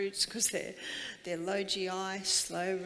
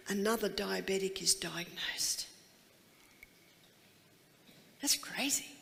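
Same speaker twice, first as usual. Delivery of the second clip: hushed aside; 2 semitones lower than usual. Shown as a demonstration. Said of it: "dramatic hushed stat".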